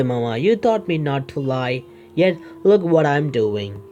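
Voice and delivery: ordinary talk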